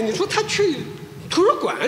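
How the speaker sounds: ordinary talk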